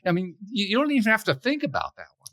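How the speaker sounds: high-pitched, gushing imitation of agreeing; mock eager agreement. Guest acting out a love fest of agreement, eagerly.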